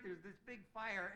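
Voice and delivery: usual speaking voice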